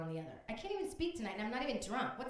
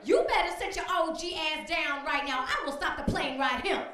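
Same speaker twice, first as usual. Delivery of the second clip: loud, much higher sassy scolding; Missus Washington; 7 semitones higher, 11 dB louder than usual. Retold or imitated. Bus driver on a plane scolding a hijacker, loud and sassy. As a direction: put the hijacker in his place, loudly. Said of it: "hear Missus Washington go".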